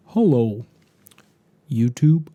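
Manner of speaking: halting, dawdling greeting; mock slow YouTuber. Pipe YouTuber opening his video, mock slow. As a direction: imitate a painfully slow video host, long pauses. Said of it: "they're doing it so slowly".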